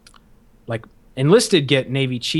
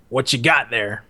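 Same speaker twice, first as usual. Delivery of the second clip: lightly voiced, lower drill instructor; drill instructor's talk; 4 semitones lower than usual. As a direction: imitate an instructor sizing up a recruit. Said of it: "that's how he, he talked".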